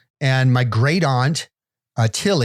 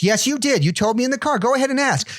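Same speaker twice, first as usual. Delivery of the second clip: insistent aunt; 11 semitones higher than usual. Retold or imitated. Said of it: "She goes".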